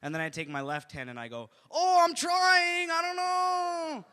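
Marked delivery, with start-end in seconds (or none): 1.7-4.1 high, loud, stretched-out cry; badly faked struggle, wailed slowly; mock helpless wail. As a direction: pretend you just can't manage it. Fakes failing to hold elevator.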